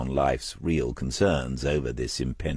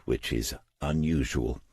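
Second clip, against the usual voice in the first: ordinary delivery, nothing marked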